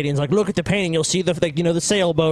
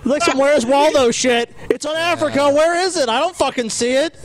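mock exasperated search; 8 semitones higher than usual. Mock frustration searching globe.

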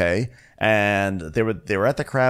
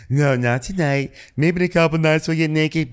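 lightly put-on, higher hostess voice; Dorinda impression; 8 semitones higher, 3 dB louder than usual. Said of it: "And Dorinda's like".

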